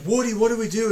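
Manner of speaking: imitating a panicked toy, high and loud; hammed-up cry for help to Woody; panicked plea. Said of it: "And he's like".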